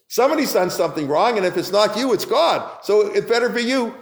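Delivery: indignant rebuke. Acted. Acted rebuke of Rav Huna.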